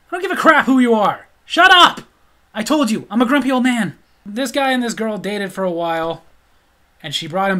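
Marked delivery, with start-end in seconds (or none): 0.1-4.0 much louder and higher, peaking on shut up; furious shout. Retold or imitated. Grumpy dad shouting down a nervous teen, loud and furious. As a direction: bark him into silence.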